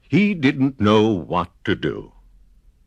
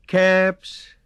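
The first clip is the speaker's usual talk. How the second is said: high, slow street-seller call; 11 semitones higher, slower than usual. A narrator's reading. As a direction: sing out the wares like a peddler. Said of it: "he walked back to town calling".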